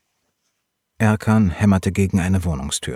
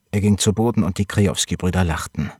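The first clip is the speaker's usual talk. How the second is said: usual speaking voice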